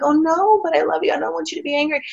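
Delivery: put-on high, anxious pleading to her boyfriend. Retelling how she begged her angry boyfriend, high and pleading.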